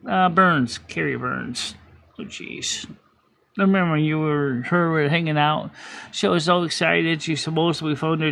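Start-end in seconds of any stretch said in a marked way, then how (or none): 2.2-2.9 whispered oh jeez under the breath; soft exasperated whisper; breathed aside. Real, not acted. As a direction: let it slip out as a breath. Under-breath reaction to name.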